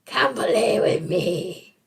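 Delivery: slow eerie whisper; creepy whisper. Acted. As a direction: lure them in, whispering slowly. Creepy fairy follow-up.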